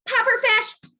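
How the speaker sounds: high, loud yelled command; playful yell. Shown as a demonstration. Parent shifting focus during a meltdown, yelling. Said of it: "you can call out these little commands".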